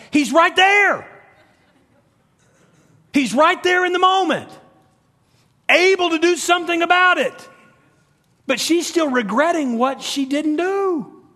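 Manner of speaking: high, loud, urgent preaching; preaching at full force. Real, not acted. Preacher exhorting the congregation, loud and high. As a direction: raise the voice and drive it home.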